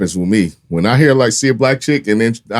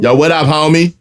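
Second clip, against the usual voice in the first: higher, teasing LA homie greeting; LA homie accent; 6 semitones higher, 4 dB louder than usual. Retold or imitated. Teasing friend on how outsiders hear her, mock LA accent. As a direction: exaggerate the LA "homie" talk. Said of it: "That's how they feel like you sound".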